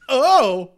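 loud, high-pitched "oh"; acted-out startled cry. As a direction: perform a sudden yelp as the lawyer. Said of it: "I have to just perform it".

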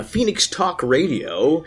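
slow, drawn-out station name; radio voice. Real, not acted. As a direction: announce it like a DJ. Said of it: "Your radio voice just turned on".

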